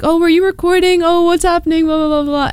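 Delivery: high-pitched imitation of nosy questions; mock curious onlookers. People asking about her recording, high mock curiosity.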